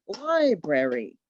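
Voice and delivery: Library said very slowly in even beats; clapped syllables. Shown as a demonstration. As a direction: clap out each beat of library. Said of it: "clap it out".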